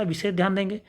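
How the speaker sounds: normal talking voice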